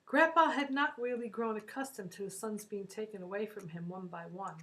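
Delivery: plain reading voice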